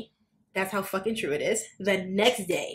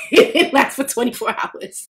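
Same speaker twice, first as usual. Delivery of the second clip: laughing; 4 semitones higher, 6 dB louder than usual. Real, not acted. Laughs at short-lived reconciliation.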